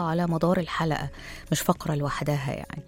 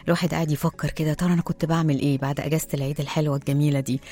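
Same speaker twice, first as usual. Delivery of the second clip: ordinary talk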